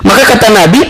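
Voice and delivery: ordinary talk, nothing marked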